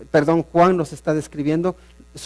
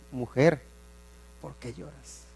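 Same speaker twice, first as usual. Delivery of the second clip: tender Jesus question; 3 semitones lower, 11 dB quieter than usual. Acted. Jesús pregunta tiernamente a María.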